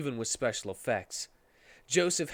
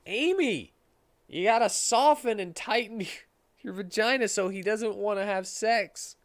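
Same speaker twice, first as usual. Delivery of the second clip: high character voice; 7 semitones higher than usual. Acted. Narrator voicing a character line to Amy, higher put-on voice.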